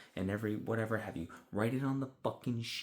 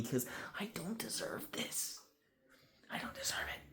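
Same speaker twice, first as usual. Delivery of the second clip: mock gentle whisper; 9 semitones higher, 6 dB quieter than usual. Worker gentle parenting rude callers, whispering mock hurt. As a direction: drop to a whisper to set the boundary.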